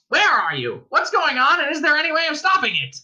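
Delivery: high, loud, furious quacking. A narrator's reading. Ford as penguin demanding answers from voice, quacking. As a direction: quack frantic questions like an angry bird. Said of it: "he quacked".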